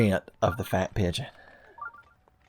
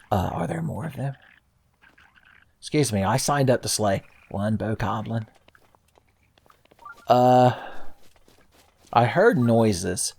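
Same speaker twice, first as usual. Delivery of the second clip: ordinary talk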